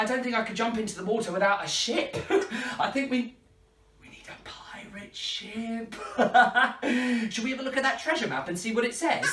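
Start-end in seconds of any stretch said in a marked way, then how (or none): 4.0-5.9 soft laughing whisper. Real, not acted. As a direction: whisper it through a giggle.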